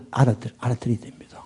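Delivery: fast, hushed whisper. Real, not acted. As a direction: urge them in a quick whisper. Hushed aside to congregation. (빠르게 속삭이며)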